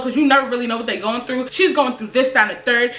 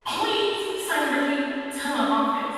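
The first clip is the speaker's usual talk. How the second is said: thin, soft voice as if over a school intercom; 2 semitones higher, 7 dB quieter, slower than usual. Retold or imitated. School office calling over the intercom, soft PA voice. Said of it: "a intercom thing that's like".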